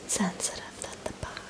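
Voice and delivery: ordinary talk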